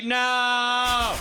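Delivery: long, drawn-out protest; hammed-up wail of refusal; mock horrified cry. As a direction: stretch the word out in comic horror. Acted horror at germ orgy.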